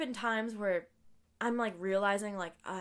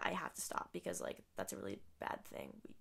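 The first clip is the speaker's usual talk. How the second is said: whispered, hushed retelling; whispering; 13 semitones lower, 13 dB quieter than usual. Retold or imitated. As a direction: say it under your breath. Retelling what she was told, whispering.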